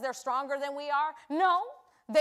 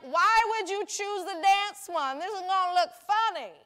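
mock disbelief; 6 semitones higher, 7 dB louder than usual. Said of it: "I'm like".

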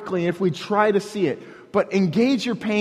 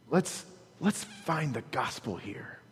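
much softer, lower comforting line; gentle pastoral; 5 semitones lower, 13 dB quieter than usual. Shown as a demonstration. Pastor sitting with a grieving friend, soft and gentle. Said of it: "I'll probably say it just like that".

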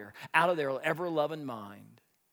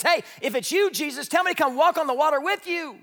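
loud, much higher call across the storm; 12 semitones higher, 11 dB louder than usual. Acted. Peter calls to Jesus across the water, loud call. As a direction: shout out over the waves to him.

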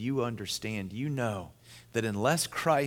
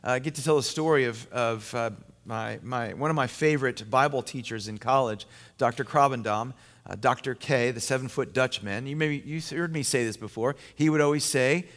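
ordinary talk

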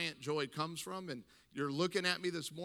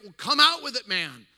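much higher and louder, urgent; impatient excitement; 6 semitones higher, 12 dB louder than usual. Retold or imitated. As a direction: burst out, desperate to hear it. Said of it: "He's like".

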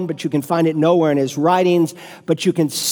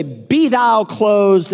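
mock pious; 3 semitones higher, much slower than usual. Preacher mocking a pious man refusing help, slow and solemn. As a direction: intone it like a smug blessing, then shut the door. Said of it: "And you say".

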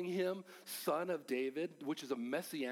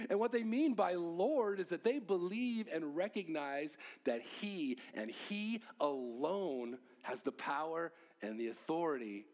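usual speaking voice

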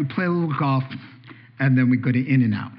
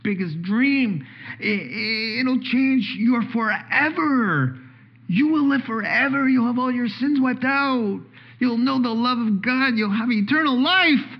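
preaching climax; 8 semitones higher than usual. Real, not acted.

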